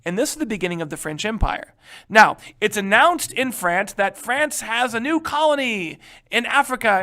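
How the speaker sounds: normal talking voice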